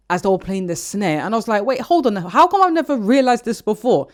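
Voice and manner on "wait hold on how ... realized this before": high, loud, astonished self-quote; incredulous outburst, acted out; amazed realisation. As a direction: re-enact stopping short in disbelief. Retelling own amazed realisation.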